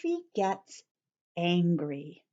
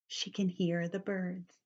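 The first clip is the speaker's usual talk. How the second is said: ordinary delivery, nothing marked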